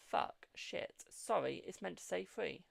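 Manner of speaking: ordinary talk, nothing marked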